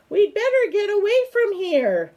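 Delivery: high, frightened little squeak; squeaking in fear. Acted. Fireflies fleeing the spider, high frightened squeak.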